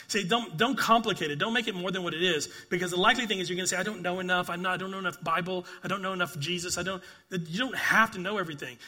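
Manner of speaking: loud, rising preacher's voice, driving each point home; forceful preaching. Acted. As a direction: preach it out to the congregation with full force.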